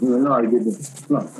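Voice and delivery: normal talking voice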